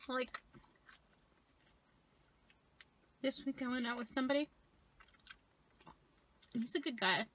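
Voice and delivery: talking while chewing; licking fingers between words; mouth partly full. Real, not acted. Seen 2: chews and licks fingers at mouth throughout the labelled words.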